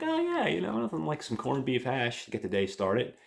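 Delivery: high, goofy voice, pleased with himself; cheery dude. Retold or imitated. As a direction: croon it like a proud cook. Brother happily cooking breakfast.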